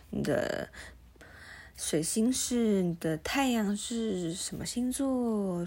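usual speaking voice

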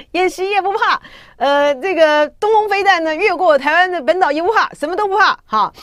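high, loud mock-fearless boasting; teasing show of fearlessness; mock bravado. Mimics bravado of fearless public.

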